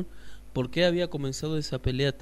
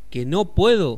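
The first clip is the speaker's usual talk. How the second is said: indignado; 5 semitones higher, 11 dB louder than usual. Acted. El Piojo indignado.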